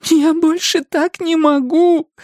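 high, slow anguished cry; woman breaking into tears; tearful despair. A narrator's reading. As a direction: give up in high-pitched distress. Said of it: "Она заплакала".

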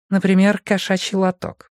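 high-pitched female interjection; Ronda. A narrator's reading. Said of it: "вставила Ронда". (высокий женский голос)

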